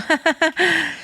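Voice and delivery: high-pitched laughter. Real, not acted. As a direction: burst of high, light laughter. Laughing after her own retort.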